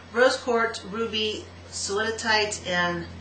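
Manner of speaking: usual speaking voice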